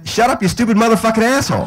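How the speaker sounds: put-on high, loud, fast kid outburst; imitated seven-year-old yelling a swear; little boy cursing. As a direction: belt out the bad word like a small child. Little boy gleefully repeating the curse.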